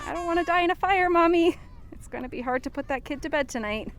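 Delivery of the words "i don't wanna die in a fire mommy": put-on high, fast child's wail; a lightly voiced crying child; crying kid. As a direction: mimic a scared crying kid. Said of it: "That one's saying".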